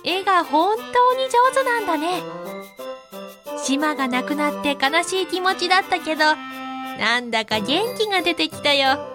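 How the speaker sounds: put-on high, fast, joyful little boy; bursting with happy wonder; delighted young zebra. A narrator's reading.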